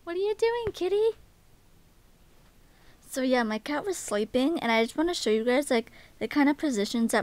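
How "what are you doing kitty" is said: much higher sing-song voice to the cat; pet talk. Real, not acted. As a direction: coo at the kitty. Talking to her cat.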